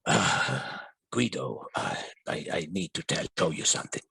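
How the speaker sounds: a hint of a low, soft father; somber father. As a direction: quote the father low and sombre. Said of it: "in his somber, somber voice".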